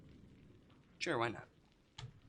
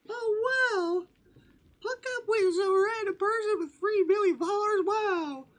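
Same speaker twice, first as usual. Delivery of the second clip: high mock outrage; 11 dB louder than usual. Real, not acted. Reacting to chat, mock outrage.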